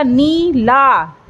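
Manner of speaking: "नीला" very slowly, both vowels held long; "नीला" in two drawn-out syllables; stretched vowels. Shown as a demonstration. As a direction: pull out "नी", then a long "ला". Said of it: "लेकिन मैंने क्या बोला".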